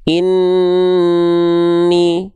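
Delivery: INNI with a long, steady hum on the N; held nasal N. Shown as a demonstration. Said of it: "itu kita tahan dua harokat". ("Inni", nun ditahan dua harakat)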